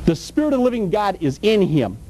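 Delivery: ordinary talk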